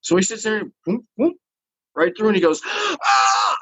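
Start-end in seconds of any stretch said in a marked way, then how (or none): 2.7-3.6 gasp, then high-pitched mock wail; retold crying yelp. Imitating the new guy as he breaks down, gasping yelp. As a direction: imitate a sudden high cry. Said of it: "he just starts crying".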